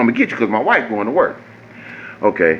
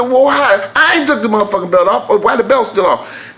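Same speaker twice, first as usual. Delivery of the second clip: indignant complaint; 9 semitones higher than usual. Retold or imitated. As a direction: demand an answer. Arguing with mechanic on phone.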